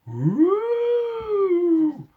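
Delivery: high wailing siren noise. A narrator's reading. Narrator imitates the wartime siren, high loud wail. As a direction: wail it like an air raid siren.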